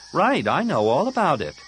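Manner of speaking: high, loud dismissive comeback; sarcastic retort. Acted. Tahiri brushes off her friend's excuse, sarcastic. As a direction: cut off his excuse, pitched high. Tahiri scolding Anakin for absence.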